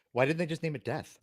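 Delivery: ordinary talk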